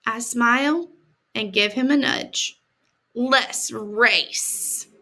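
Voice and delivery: put-on reading a picture book to kids; gentle read-aloud for children; story reading. A narrator's reading. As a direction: tell it like a storybook to a class.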